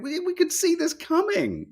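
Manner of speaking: lightly voiced told-you-so; mock told-you-so. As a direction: mimic the world saying they knew. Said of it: "everyone else going".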